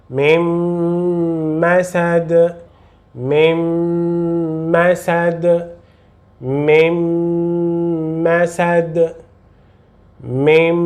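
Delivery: "Mim" with the nasal m held long before "masad"; lengthened nasal. Shown as a demonstration. Teacher models long nasal for learners: drawn out. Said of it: "Você deve sempre alongar o som".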